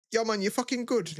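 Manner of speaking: high-pitched, matey hail; imitated upbeat greeting to a mate; friendly check-in. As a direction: check on an old pal, pitched right up.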